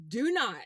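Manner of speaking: "Do not" pushed high and loud in imitated begging; emphatic retold plea. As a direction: punch "Do not" as a mock plea.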